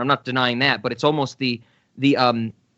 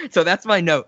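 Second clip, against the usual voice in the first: ordinary talk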